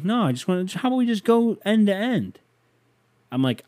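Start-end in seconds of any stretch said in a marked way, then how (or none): 0.0-2.4 much higher, mock-kiddie voice; squeaky little-kid pitch, mocking him; cocky kid. Thirteen-year-old pushes the man to race, high mock kid voice. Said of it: "And he goes".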